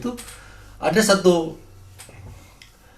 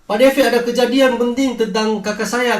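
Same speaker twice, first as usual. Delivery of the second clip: high-pitched, mildly raised mock call; retold shout; 9 semitones higher, 4 dB louder than usual. Friend calls to Pak Devi from the car, mild retold shout. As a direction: imitate someone calling out, pitched well up. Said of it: "dia teriak dari mobil".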